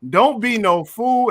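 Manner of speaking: slow, drawn-out title call; slow show-segment title; segment title announce. Acted. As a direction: announce the segment name with weight. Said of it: "our patented".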